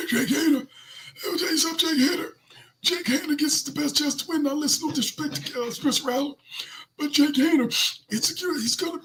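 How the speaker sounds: high-pitched, squeaky put-on voice; mock team spokesman. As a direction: play a halting spokesman in a very high voice. High put-on voice of a figure defending the call.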